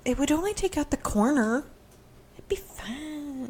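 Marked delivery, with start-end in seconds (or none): 2.4-3.5 whispering. Pretend. Mock reassuring whisper.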